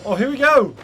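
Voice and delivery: bright, loud burst of excitement; sudden thrilled exclamation; excited. Real, not acted. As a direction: hype it up, here we go. Streamer reacting to playing Childe.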